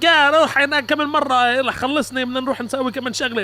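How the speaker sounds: high, loud rapid Arabic banter; صراخ عادي بين الأهل. Retold or imitated. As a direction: sound like a fight while making plans. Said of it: "you think we're yelling at each other".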